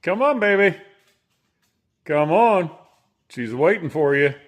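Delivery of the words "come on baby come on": calling. Real, not acted. Calling the pet inside.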